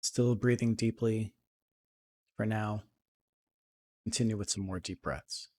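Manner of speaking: soft, unhurried meditation voice with long pauses; guided meditation. Acted. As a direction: settle into a quiet, even, calming guide's voice.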